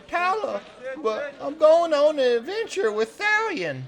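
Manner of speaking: pleading fish-camp hand. Acted. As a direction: plead with the boss in a high, simple voice. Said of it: "He runs over and he says".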